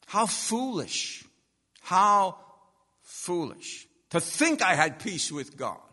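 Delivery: loud, drawn-out "how foolish"; emphatic self-rebuke. Real, not acted. Preacher looking back on his unsaved self, emphatic rebuke. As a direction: preach it with sudden force.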